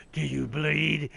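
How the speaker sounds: Batman growl. Retold or imitated. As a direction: drop into a slow Batman rasp. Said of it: "and he goes".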